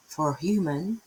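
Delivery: ordinary delivery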